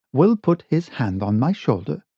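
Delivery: ordinary delivery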